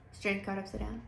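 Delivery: ordinary talk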